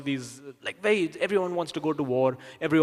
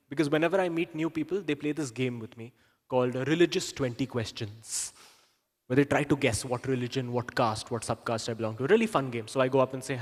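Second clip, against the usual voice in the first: ordinary talk, nothing marked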